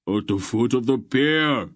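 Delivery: high, loud shouted reply; shouting. A narrator's reading. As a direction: call it across the crowd. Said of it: "Barak shouted".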